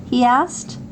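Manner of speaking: ordinary delivery, nothing marked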